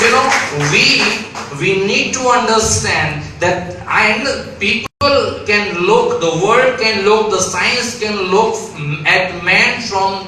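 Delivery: impassioned sermon climax; preaching. Acted. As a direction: preach it with full fervor.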